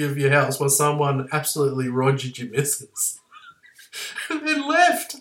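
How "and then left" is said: high, slow words breaking into laughter; laughing. Real, not acted. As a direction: laugh it out, barely holding the words. Laughing at his own story.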